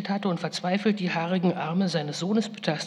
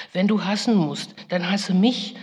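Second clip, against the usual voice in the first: raised, louder pleading; imploring; 3 semitones higher, 6 dB louder than usual. Retold or imitated. Mother imploring her furious son Esau, raised voice. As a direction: beg him to turn his hate on you. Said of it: "beschwor ich ihn".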